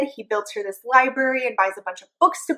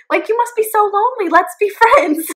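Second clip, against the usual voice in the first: high, quick, loud mock-gushy offer; gushing eagerness; 10 semitones higher, 5 dB louder than usual. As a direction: gush it with a laugh breaking in. Girl suddenly offers friendship to monster.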